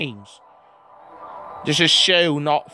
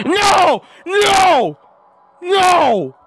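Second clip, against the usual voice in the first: very high, loud drawn-out cries of protest; anguished shouting; 7 dB louder, much slower than usual. Real, not acted. Player losing to the computer, anguished shouting. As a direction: scream it in disbelief at losing.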